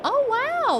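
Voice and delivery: high, bright surprise; delighted wow. Real, not acted. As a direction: wow it like you're amazed. Delighted by new area.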